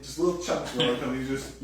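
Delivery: ordinary delivery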